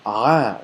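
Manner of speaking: "ah" from the throat, as ain; Arabic ain sound. Shown as a demonstration. Teacher demonstrating ain from the throat for students. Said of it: "that's gonna make the ah".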